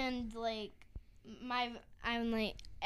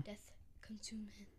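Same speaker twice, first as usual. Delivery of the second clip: eerie hushed whisper; dramatic whisper; 2 semitones lower, 14 dB quieter than usual. Pretend. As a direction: breathe it out ominously.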